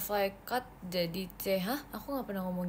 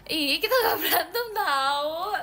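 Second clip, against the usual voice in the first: higher and louder, laughing through the denial; laughing protest; 8 semitones higher, 8 dB louder, slower than usual. Real, not acted. To her friend, denying the fight while laughing. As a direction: giggle while insisting you never fought.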